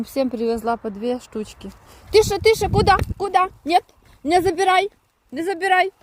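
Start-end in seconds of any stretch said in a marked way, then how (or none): 2.1-3.8 high, loud sharp hush; scolding shout. Real, not acted. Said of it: "я сварюсь просто". 4.2-5.9 high, loud telling-off; scolding. Real, not acted. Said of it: "я сварюсь просто".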